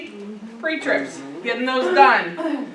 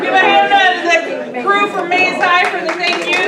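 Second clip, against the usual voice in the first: loud announcement; 6 semitones higher, 6 dB louder than usual. Real, not acted. Announcing applause for the crew.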